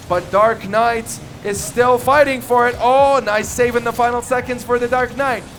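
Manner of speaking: ordinary talk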